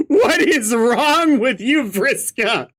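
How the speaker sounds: high-pitched exasperated outcry; appalled. Real, not acted. Reader scolding Vriska, high appalled outcry.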